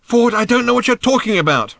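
high, loud protest; high-pitched frustrated holler; exasperated shout. A narrator's reading. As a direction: yell it in bafflement. Shouted argument on the beast.

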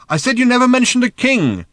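high, loud repeated protest; strained high-pitched shout. A narrator's reading. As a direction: yell it to be heard over noise. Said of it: "shouted Arthur again".

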